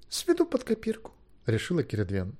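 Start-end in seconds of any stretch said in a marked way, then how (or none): 0.1-1.1 put-on high, light girlish voice; decided girl. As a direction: make up your mind, high and quick-witted.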